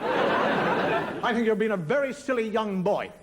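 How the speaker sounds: slow, loud telling-off; prim scolding. Acted. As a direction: reprimand a naughty child deliberately. Adult scolds prank-calling child.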